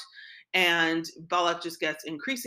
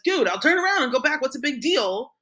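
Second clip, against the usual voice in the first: higher, louder, fed-up dude; a retold Bilam, mock-exasperated and raised; exasperated retort; 5 semitones higher, 6 dB louder than usual. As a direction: toss it back, annoyed at the fuss.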